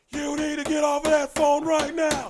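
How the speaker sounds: high, very loud shouted order; full-volume, high-pitched bossy holler; imitating her yelling. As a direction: yell it like you're scolding across the room. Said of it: "it's him she's yelling at".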